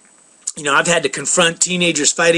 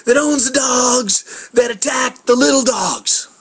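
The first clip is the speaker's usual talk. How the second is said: imitating a yell; 6 semitones higher, slower than usual. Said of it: "he yells at me and he goes".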